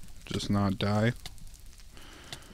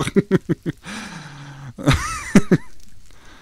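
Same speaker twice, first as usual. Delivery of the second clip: shrieking high laugh at his own death; high laughing fit; 10 semitones higher, 4 dB louder than usual. Real, not acted. Laughing at the game killing him, high squealing laugh.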